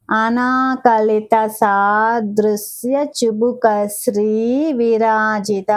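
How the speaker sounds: the whole name drawn out, ధర lengthened at the end; slow careful recitation. Shown as a demonstration. Teacher modelling the full name for students, slow. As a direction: recite it slowly, stretching the last syllable. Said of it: "slow recitation demo".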